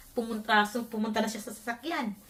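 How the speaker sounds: ordinary delivery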